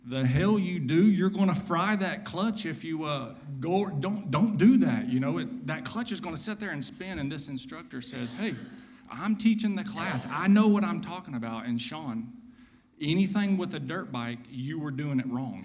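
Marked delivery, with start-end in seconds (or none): 0.1-7.2 louder and higher, hammed-up indignation; retold outburst. Sean snapping at the instructor, loud mock outrage. 8.3-11.7 much higher and louder, mock indignant; retold retort. Instructor telling Sean off, loud mock indignation.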